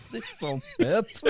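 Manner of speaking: giggling through the words; bursting out laughing. Real, not acted. Laughing at fart sound.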